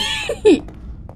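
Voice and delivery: squeaky self-mocking laugh; high giggle. Real, not acted. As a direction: giggle at your own forgetfulness. (risadinha aguda)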